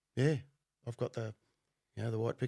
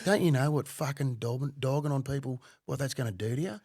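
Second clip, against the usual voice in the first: retold heated telling-off, higher and louder in bursts; ripping into him; 4 semitones higher, 4 dB louder than usual. Ex-undercover worker scolding an informant, ripping into him. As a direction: lay into the caller, acting out the rant. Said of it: "I'm just ripping into him".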